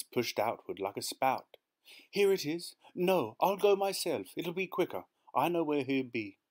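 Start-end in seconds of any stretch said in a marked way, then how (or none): 2.1-6.4 high, insistent voice; agitated urgency. A narrator's reading. Wife deciding to fetch husband herself, agitated urgency. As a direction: take charge in a fluster.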